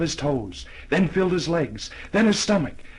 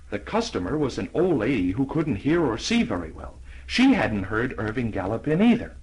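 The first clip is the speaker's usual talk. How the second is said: usual speaking voice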